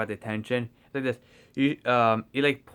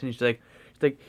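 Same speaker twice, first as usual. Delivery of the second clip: ordinary talk, nothing marked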